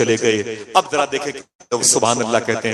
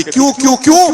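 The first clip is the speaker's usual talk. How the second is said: anguished wail; 13 semitones higher, 7 dB louder than usual. Acted. Widow hears of husband's death.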